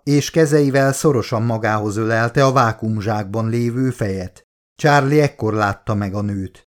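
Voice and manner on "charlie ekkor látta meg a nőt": ordinary delivery, nothing marked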